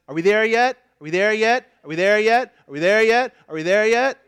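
put-on loud whiny kid nagging; pestering child. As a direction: nag like a bored kid in the back seat. Kids pestering on road trip.

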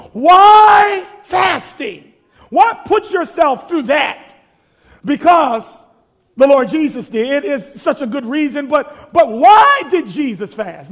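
slow, loud, rising preacher's cry; preaching. Acted. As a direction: preach it at full force. Preacher builds to full force.

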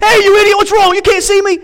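very high, loud mock yell; instant road-rage outburst. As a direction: explode at the driver who cut you off. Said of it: "it's an instant".

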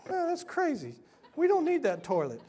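mock dismissive. Own retort acted.

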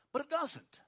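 ordinary talk

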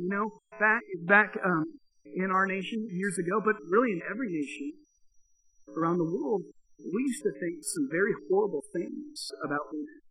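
ordinary delivery

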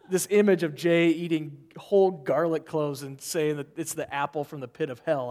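usual speaking voice

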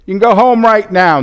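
loud call to troops. Acted. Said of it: "He goes".

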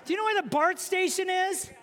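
high, innocent asking voice; bright polite question. Retold or imitated. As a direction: chirp the question sweetly. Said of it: "I just went".

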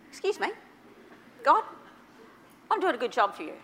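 high, loud, laughing protest; mock indignant. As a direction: play offended with a laugh. Indignant reply to God.

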